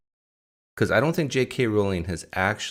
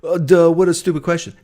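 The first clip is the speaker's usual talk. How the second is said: higher, louder, dopey mocking voice; mock dismissive; 9 semitones higher, 10 dB louder, faster than usual. Mimicking viewers who scoff at his question, loud and dopey. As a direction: play a scoffing know-it-all. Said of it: "don't just go".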